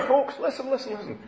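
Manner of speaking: high but soft call for attention; half-hearted crowd-hailing, pitched well up. Acted. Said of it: "raised his voice".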